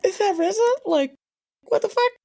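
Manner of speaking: high-pitched disbelief; shocked reaction. Retold or imitated. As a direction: relive a stunned double take. Retelling shock at seeing rapper.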